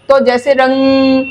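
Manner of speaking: रंग slow, its ं sounded through the nose; slow nasal रंग; slow nasal demo. Shown as a demonstration. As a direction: hum the ं of रंग. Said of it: "यहाँ नासिका से स्वर उच्चारण होता है".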